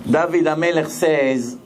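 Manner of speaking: ordinary talk, nothing marked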